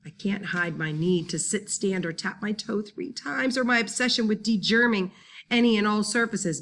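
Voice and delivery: plain reading voice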